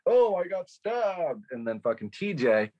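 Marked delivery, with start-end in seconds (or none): 0.0-1.5 higher and slow, an odd hammed-up cry; weird mock delivery. Retelling Jeff's goofy movie line, hammed-up, high and slow. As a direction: drag it out, pitched up, like a goofy fake stabbing. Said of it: "Jeff does this weird".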